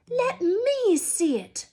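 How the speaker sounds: high, loud, slow demand; insist, drawn out and loud. Acted. Son demanding to see the letter, high and loud. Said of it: "demanded Dudley".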